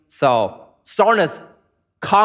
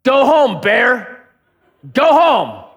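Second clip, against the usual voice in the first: louder, higher firm order to a bear; firm command; 6 semitones higher, 4 dB louder, much slower than usual. Retold or imitated. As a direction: shoo the bear off like a dog. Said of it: "I said".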